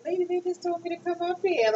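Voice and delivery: mock indignant reply; exasperated. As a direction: push back at the rude nurse. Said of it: "and I'm like".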